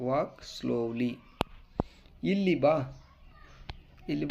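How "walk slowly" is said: slow model. Shown as a demonstration. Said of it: "teacher models English phrases".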